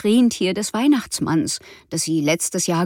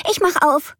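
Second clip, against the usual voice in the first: put-on eager girl; 9 semitones higher than usual. A narrator's reading. Excited girl at door.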